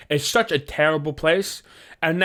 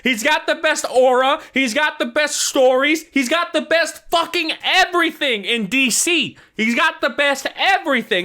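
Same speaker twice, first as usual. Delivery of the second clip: gushing; 7 semitones higher than usual. Real, not acted. Raving about Batman.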